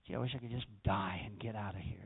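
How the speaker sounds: soft, low, breathless whisper; whispered thought. Retold or imitated. A weary believer's secret death wish, whispered low and soft. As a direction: let the thought slip out under your breath. Voicing a passing despairing thought.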